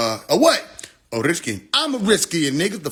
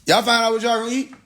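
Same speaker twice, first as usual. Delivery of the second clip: calling out; 8 semitones higher than usual. Real, not acted. Calling out to wife off-mic.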